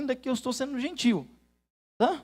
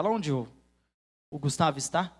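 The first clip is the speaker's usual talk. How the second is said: ordinary delivery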